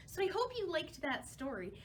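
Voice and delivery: normal talking voice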